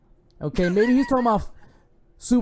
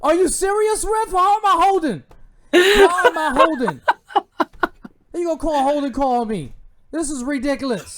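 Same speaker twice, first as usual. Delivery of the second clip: yelling at the ref; 9 semitones higher, 6 dB louder than usual. Retold or imitated. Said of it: "J P P like".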